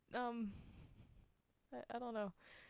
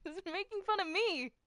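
indignant cry; 10 semitones higher, 4 dB louder than usual. Acted. Heated protest about blind joke.

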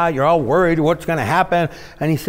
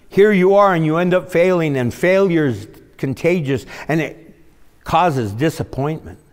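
ordinary delivery

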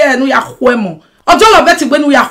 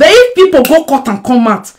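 normal talking voice

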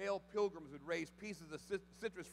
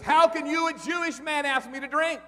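loud, high outraged cry; the Samaritan woman flaring up at Jesus, loud and high; indignant shout; 12 semitones higher, 19 dB louder than usual. Retold or imitated. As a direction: hurl it back at him, offended. Woman's indignant retort in story.

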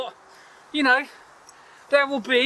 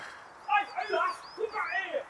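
hushed call; 8 semitones higher, 11 dB quieter, slower than usual. Real, not acted. Calling after someone leaving.

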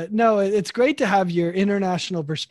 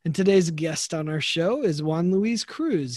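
ordinary talk

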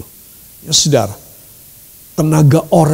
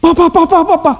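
put-on higher, calling out like a scared child; frightened child; 9 semitones higher, faster than usual. Child waking from a nightmare, calling for papa in fear. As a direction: cry for papa after a nightmare.

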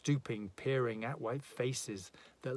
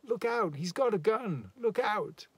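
high, loud scream of warning; woman screaming a warning as a gun appears; 10 semitones higher, 6 dB louder than usual. Acted. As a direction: scream it in panic. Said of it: "Queenie's warning scream".

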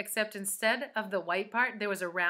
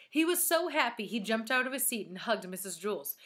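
ordinary delivery

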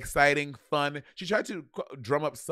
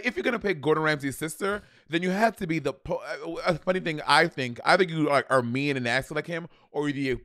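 usual speaking voice

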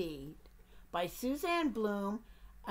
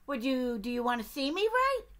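eager duck; 3 semitones higher, 5 dB louder than usual. Acted. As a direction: pester the bear as a nosy duck. Duck's voice.

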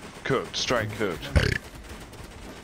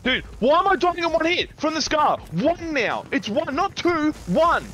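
high, loud disbelieving rant; high outraged shout; 3 dB louder than usual. Real, not acted. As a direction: yell at the game in outrage. Raging at one-hit deaths.

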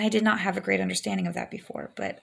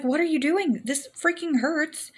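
pained mock yell; 8 semitones higher than usual. Retold pain during water breaking.

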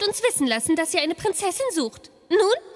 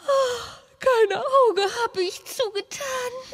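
slow lament; 4 semitones higher, slower than usual. Acted. Prinzessin klagt langsam.

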